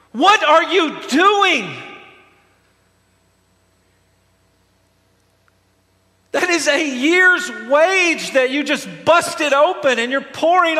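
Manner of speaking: high, loud outraged scolding; infuriated. Acted. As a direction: berate her in acted fury. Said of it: "They are infuriated with her".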